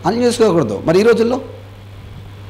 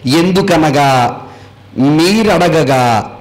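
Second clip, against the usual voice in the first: slow scripture reading; 6 semitones lower, much slower than usual. Acted. Reads scripture slowly.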